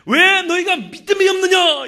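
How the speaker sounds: loud, high, drawn-out scolding shout; stern rebuke. Acted. Preacher voices Jesus scolding the faithless, stern rebuke. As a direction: rebuke them at full voice, stretching the words. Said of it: "예수님도 항상 꾸짖을 때".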